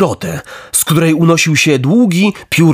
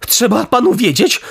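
high-pitched, mildly indignant retort; 7 semitones higher than usual. A narrator's reading. Pinokio objects to being called a fish, high indignant voice. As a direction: protest with raised pitch. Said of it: "zawołał z oburzeniem".

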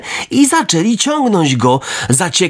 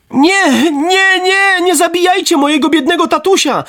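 desperate cry. A narrator's reading. Pinokio begs robbers to spare his dad, desperate high cry. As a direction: beg in terror for your father's life. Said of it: "krzyknął Pinokio zrozpaczonym tonem".

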